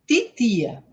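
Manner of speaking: titia com T seco, sem tch; T puro. Shown as a demonstration. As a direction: diga titia com t duro, sem chiar. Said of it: "como se fosse um T de". (titia com T seco)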